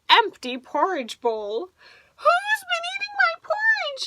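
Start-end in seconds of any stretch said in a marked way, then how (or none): 2.2-4.1 very high, tearful wail; crying baby bear. Acted. Baby bear crying over the empty porridge bowl. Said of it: "he cried".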